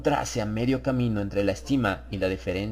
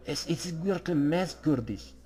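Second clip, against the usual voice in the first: Harris musing; 5 semitones higher, 6 dB quieter, slower than usual. A narrator's reading. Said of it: "dijo Harris".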